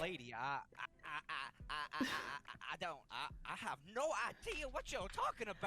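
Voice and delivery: stammer, then burst out high and loud; panicked, stuttering denial; flustered stammering. Acted. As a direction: protest innocence, tripping over the first word. Accused player stammers in character.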